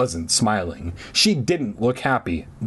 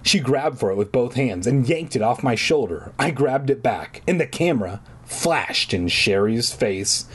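plain reading voice

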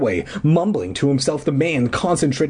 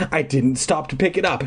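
ordinary delivery

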